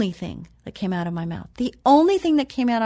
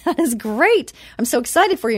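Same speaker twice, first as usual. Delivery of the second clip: retold excitement; 4 semitones higher, 4 dB louder than usual. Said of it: "And I said".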